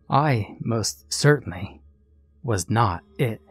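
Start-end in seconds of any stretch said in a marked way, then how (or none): none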